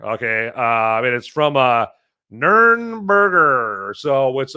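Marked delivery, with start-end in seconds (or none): 2.3-4.0 "Nürnberger" stretched very slowly, higher pitch; drawn-out pronunciation attempt. Shown as a demonstration. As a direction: drag out each syllable of "Nürnberger". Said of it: "the pronunciation of that right there".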